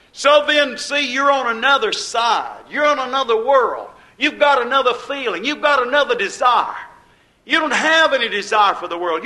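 preaching at full force. Acted. Preacher telling believers they're on another side, preaching hard. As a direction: drive it home to the flock.

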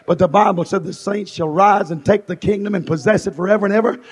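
ordinary delivery

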